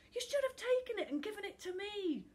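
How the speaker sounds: high, loud, bitter reproach. A narrator's reading. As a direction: complain bitterly, pitched right up. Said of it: "Charlie said bitterly".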